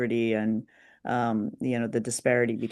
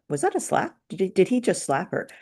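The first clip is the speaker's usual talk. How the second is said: higher, incredulous double-take question; a touch of mock shock, pitched higher; retold disbelief; 6 semitones higher than usual. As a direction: ask it in high-pitched disbelief, lightly replayed. Retelling own shocked reaction.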